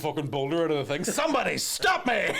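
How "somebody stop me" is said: slow, high comic yell; hammed-up shout, higher and slow; Jim Carrey shout. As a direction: belt it out like the Mask. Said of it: "Jim Carrey in The Passion".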